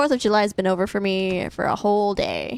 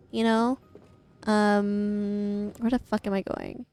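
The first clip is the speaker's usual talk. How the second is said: ordinary talk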